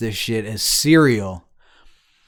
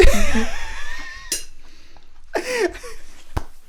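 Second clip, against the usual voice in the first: long, high-pitched helpless laugh; high laughing fit. Real, not acted. Friend cracking up at the other's no comment, high laughing fit.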